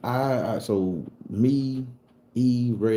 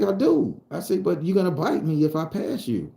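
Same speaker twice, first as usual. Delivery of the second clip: higher, louder protest to the snake; agitated retort; 10 semitones higher, 4 dB louder than usual. Retold or imitated. Boy answering a talking snake, agitated. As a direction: answer back high and wary.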